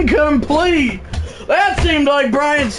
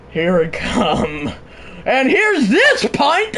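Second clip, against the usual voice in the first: normal talking voice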